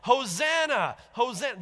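high, loud festive shout; yelling hosanna. Retold or imitated. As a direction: cheer it like a crowd at the gate. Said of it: "that are there yelling".

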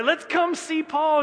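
high, slow, thrilled call of an eager fan; eager crowd. Retold or imitated. Churchgoers eager to see Paul, excited high call. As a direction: sing it out like a fan rushing for front row seats.

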